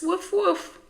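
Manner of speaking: very high, loud puppy barks; dog barking. Acted. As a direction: bark up at the cloud. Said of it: "she shouts".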